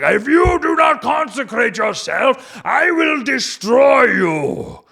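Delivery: high, loud shouting threat; wrathful God threatening the people, mock shout; mock furious God. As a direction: bellow the threat, hammed up. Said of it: "Now he's like".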